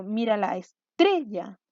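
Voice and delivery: slow "estrella" as es-TRE-lla, TRE drawn out; stress on middle syllable. Shown as a demonstration. Teacher testing each syllable in a sentence, "estrella" stressed on TRE. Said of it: "vamos a ver dónde golpea más fuerte el sonido".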